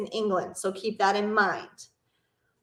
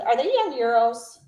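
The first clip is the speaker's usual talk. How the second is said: ordinary talk, nothing marked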